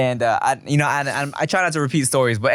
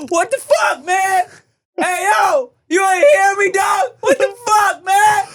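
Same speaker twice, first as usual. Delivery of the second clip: high-pitched, loud comic shouting; outraged baby impression; 7 dB louder, slower than usual. Dad voicing abandoned baby in crib, outraged high shouting. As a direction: yell it as a furious, squealing baby. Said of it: "she looked at me like".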